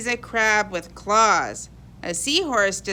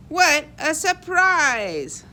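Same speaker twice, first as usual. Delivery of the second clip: surprised; 5 semitones higher, 3 dB louder, slower than usual. Acted. Pete finally finds the seahorse.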